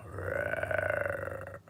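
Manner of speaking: breathy low growl; a hidden bear, heard not seen; whispered bear roar. Acted.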